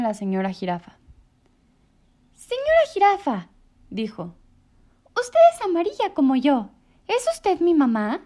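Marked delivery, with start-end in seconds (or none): 2.3-3.5 high, loud small-bird call; a tiny bird hailing the giraffe; little bird voice. A narrator's reading. Little bird Choco calls out. 5.1-8.2 high, loud small-bird call; a tiny bird hailing the giraffe; little bird voice. A narrator's reading. Choco asks the giraffe.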